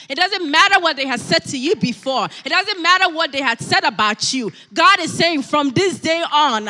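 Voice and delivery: loud, high declarations of faith; rousing sermon climax; full-force preaching. Real, not acted. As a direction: proclaim it over the congregation, punching key words.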